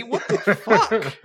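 slow, high, loud, laughing outrage; appalled shock. Acted. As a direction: stretch it out stunned. Shocked court member.

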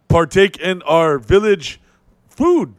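slow, halting broken-English chief. Pretend. Chief offers visitor village gifts, mock solemn voice. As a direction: make a ceremonial offer, hammed-up and slow.

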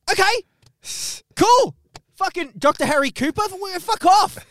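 exasperated outburst. Acted. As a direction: pitch leaps high in mock disgust.

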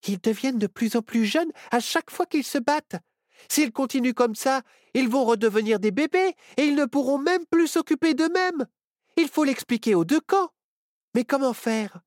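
put-on the girl sharing her alarming discovery; Myrtille's voice. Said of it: "Elle expliqua sa découverte à ses amis". (voix aiguë de Myrtille, inquiète)

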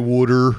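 water said as wooder in Philly style. Shown as a demonstration. Showing Philly pronunciation, water as wooder. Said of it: "they say water".

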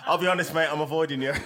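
ordinary talk

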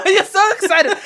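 loud, high-pitched laughing tease; shrieking with laughter. Real, not acted. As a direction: burst out laughing through the words. Laughing at co-host's accent attempt.

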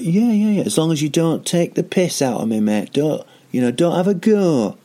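high, hammed-up Mancunian accent. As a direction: commit to an overdone, wobbly Manchester accent. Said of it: "what's happening with my accent".

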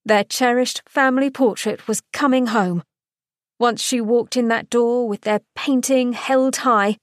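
ordinary delivery

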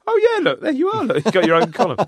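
very high, loud mock amazement; retold surprise. Fan spots the CEO's column, high-pitched mock surprise. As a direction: squeal it, playing up the discovery. Recognising the CEO in the programme.

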